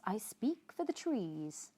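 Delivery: usual speaking voice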